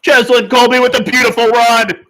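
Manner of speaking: high, loud excited yell; excited shout. Real, not acted. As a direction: shout it out in delight. Excited rugby watch-along call.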